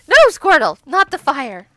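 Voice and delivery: loud, high call to stop; alarmed scolding. Real, not acted. Telling Squirtle off at the campfire, loud alarm. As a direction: cry out a warning, high and loud.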